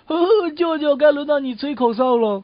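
gleeful mock calling out. Nephew turning the trick on uncle, mock gleeful call. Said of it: "并大声叫道".

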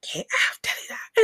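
high, strained urgent command; scolding mother. Retold or imitated. Mother ordering screaming daughter to do it, scolding.

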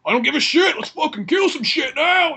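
high, mock-heroic yell; gung-ho battle shout. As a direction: holler like a robot leader itching for battle. Acting Optimus going gung-ho.

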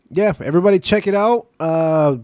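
loud, high pitch to listeners; excited plug. Real, not acted. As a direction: pump it up for the cause.

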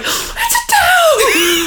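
very high, very slow awestruck gasp with a laugh; stunned realisation. Retold or imitated. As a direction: stretch it out in laughing disbelief. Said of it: "and then she's like".

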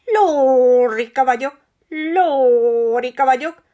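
slow, loud squawking parrot; screechy parrot voice. A narrator's reading. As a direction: screech it like a proud budgie. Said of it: "belerikácsolt egy szokatlanul éres hang".

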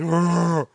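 short growled dragon noise; imitated dragon roar. Dad describing his son's morning breath, mock dragon growl. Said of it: "like dragon breath".

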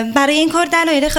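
ordinary talk

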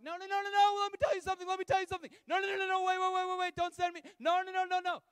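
a terrified self begging not to be sent; shrill hammed-up panic, refusing to go; panicked self-life. The clinging self voiced in panic.

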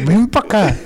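mock angry mother, high and sharp; furious mom. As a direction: bark it like an enraged mother calling her kid over. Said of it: "se me quedó viendo bien feo".

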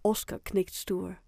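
plain reading voice

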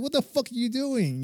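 high-pitched indignant challenge, not shouted; retold indignant outburst. Soldier confronting a British colleague at the computer, indignant. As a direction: re-enact catching someone red-handed.

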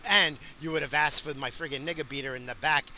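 ordinary talk

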